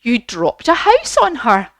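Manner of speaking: lightly voiced fairy lady pointing something out; Glinda. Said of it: "And she said".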